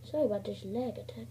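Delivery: normal talking voice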